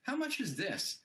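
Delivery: mock up talk ending in a question lilt. Shown as a demonstration. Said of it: "it's like".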